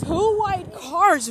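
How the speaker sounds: high, loud, slow admiring drawl; excited drawl. Real, not acted. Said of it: "real sexy".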